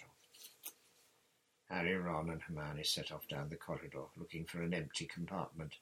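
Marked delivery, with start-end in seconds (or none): none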